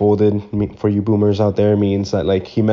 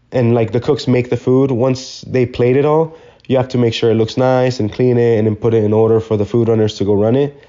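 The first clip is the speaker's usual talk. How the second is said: ordinary talk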